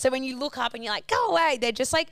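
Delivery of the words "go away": raised, higher-pitched retold yell; mock shooing yell. Swooped walker to magpie, mock alarmed yell. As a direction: act out yelling at a swooping bird.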